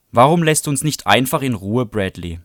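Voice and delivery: really fed up now; exasperated. Acted. Melinda losing patience with Bradley, loud and exasperated.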